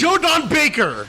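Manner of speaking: high, slow eureka shout; triumphant outburst. Real, not acted. Friend finally remembers the actor's name, triumphant outburst.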